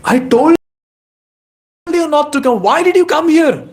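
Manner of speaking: high, exasperated scolding; acted angry reprimand; husband scolding. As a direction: tell them off, pitch shooting right up. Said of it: "The husband starts scolding".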